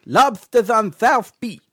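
LOBSTERS ON SOUTH BEACH with every s lisped to th; loud, slow, exaggerated lisp on LOBSTERS ON SOUTH BEACH; heavy lisp. Visitor tries a silly voice, lisping LOBSTERS ON SOUTH BEACH. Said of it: "like with a lisp?".

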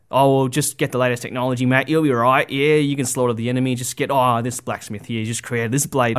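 breezy Aussie salesman pushing the latest blade; Aussie accent. Acted. Mocking the latest-tech craze, in a jokey Aussie accent. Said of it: "suddenly put on an Aussie accent".

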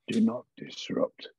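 low, slow whispered warning; voice inside the head. Acted. Game master whispers the warning inside Gerhardt's head, low and slow. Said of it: "you hear a voice inside your head".

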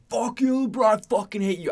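high-pitched mock fan heckle; acted heckle. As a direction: heckle a star player from the stands. Heckling LeBron at a game.

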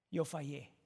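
a hushed owning of failure; quiet confession. Acted. Quiet confession refrain after pause.

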